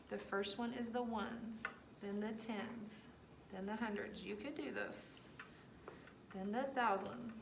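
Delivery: soft, patient walk-through; gentle reassurance. Real, not acted. Teacher softly reassuring upset boy Caden. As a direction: ease him through it quietly. Calming upset student, reteaching.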